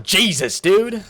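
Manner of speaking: sudden high, loud "dude!"; real hype outburst; excited shout. Real, not acted. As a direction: blurt it out in amazement. Streamer reacting to a big donation.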